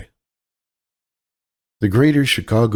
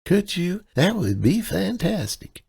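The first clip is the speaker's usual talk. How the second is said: higher, helpless Midwestern damsel voice; damsel in distress; 11 semitones higher, slower than usual. Acted. Kaylee sweet-talks the clerk as a damsel in distress. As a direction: play the sweet, lost out-of-towner, pitched up. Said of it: "her damsel in distress persona".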